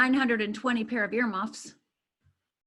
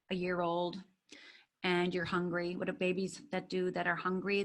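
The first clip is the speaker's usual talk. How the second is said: usual speaking voice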